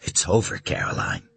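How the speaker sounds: soft, tender reassurance; calm her down gently; gentlest voice. Acted. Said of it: "in the gentlest voice he could muster".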